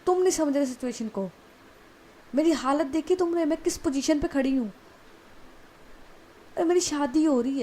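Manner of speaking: frantic angry yell. Acted. Bride yells at her brother on her wedding day, frantic and angry. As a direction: yell back in panic, cornered.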